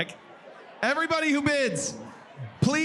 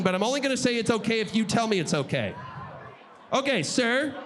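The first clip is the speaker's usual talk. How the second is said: ordinary delivery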